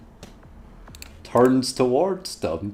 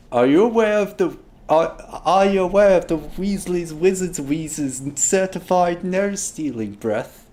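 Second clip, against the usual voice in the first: higher, showy uncle voice; hammed-up Ron; 9 semitones higher than usual. Ron teases the kids with his nose trick, showman voice. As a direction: put on a playful showman voice.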